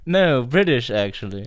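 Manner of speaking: loud, high start in a put-on British accent; mock British accent. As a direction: ham up the British catchphrase accent. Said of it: "an Austin Powers reference".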